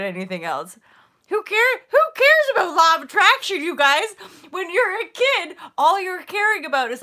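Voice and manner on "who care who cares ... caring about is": loud, high, excited outburst; exuberant rant. Real, not acted. Vlogger urging viewers to think like kids, exuberant. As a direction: shout it to everyone, way up high.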